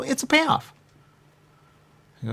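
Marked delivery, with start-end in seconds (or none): 0.0-0.7 quoting someone. Retold or imitated. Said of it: "They go".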